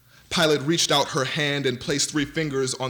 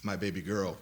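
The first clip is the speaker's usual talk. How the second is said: softer, low broken murmur; grieving murmur; 4 semitones lower, 6 dB quieter than usual. A narrator's reading. A mother over her daughter's coffin, murmuring. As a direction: let the words barely leave the throat. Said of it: "still pumping in her throat".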